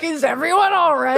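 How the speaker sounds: high, baffled disbelief; a high, startled question to the screen; incredulous. Retold or imitated. Rewatching a childhood movie, high and baffled. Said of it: "I'm like".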